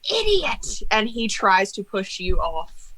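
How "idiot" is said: high, slow outraged yell; Crane's furious cry. Acted. Crane the cat yells at Sylvester, high and slow. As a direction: spit out the insult at the cat. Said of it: "Crane goes".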